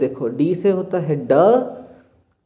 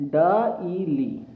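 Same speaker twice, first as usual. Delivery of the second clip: "डाईली" stretched very slowly, syllable by syllable; slow sounding out; much slower than usual. Shown as a demonstration. Teacher to students, slowly sounding out "डाईली". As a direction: draw out "डाईली" so each part is heard. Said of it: "अब हमारा अक्षर बन जाता है".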